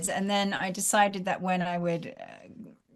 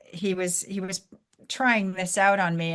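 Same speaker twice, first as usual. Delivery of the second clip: ordinary talk, nothing marked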